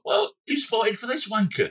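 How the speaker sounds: high, slow mock Cockney disbelief; indignant put-on Cockney; Cockney accent. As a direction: drag it out as an outraged Cockney voter. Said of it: "imagine that in a Cockney accent".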